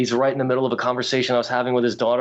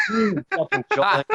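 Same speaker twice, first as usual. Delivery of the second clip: stunned laugh. Retold or imitated.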